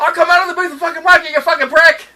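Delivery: fast, high, loud threat; swearing tirade. Retold or imitated. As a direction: snap into a swearing rant. Acting sketch swearing.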